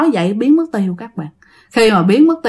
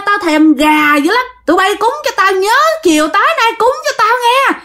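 demanding ghost; 10 semitones higher than usual. Acted. Ghost demanding offerings from villagers, high eerie threat. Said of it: "nghe một cái giọng nói".

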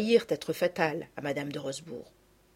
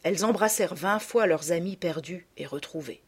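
ordinary delivery

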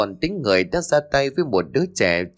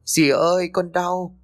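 higher, a hint of a child's pained cry; 8 semitones higher than usual. A narrator's reading. Injured boy crying to his stepmother, mild pained cry. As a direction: cry out in pain, small and scared. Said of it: "cậu càng khóc to".

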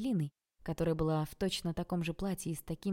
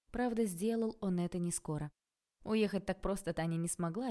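ordinary delivery, nothing marked

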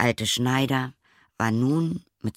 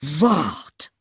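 loud, high threatening cry; angry call; 6 semitones higher, 5 dB louder than usual. A narrator's reading. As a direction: shout it as a threat. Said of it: "rief er". (drohender Ruf)

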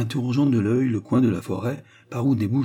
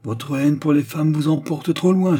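higher, louder start, lightly amused; laughing retort; 6 semitones higher, 5 dB louder than usual. A narrator's reading. Pupil teasing his teacher, mock-amused retort. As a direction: tease the master back, lightly. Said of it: "repartit en riant".

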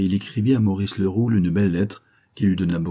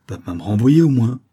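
mildly alarmed plea, louder with a rising swell; 3 semitones higher, 6 dB louder than usual. A narrator's reading. Old worker begging not to be sacked, mildly alarmed. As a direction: plead with a touch of alarm. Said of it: "alarmé".

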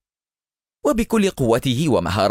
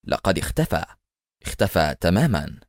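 ordinary delivery

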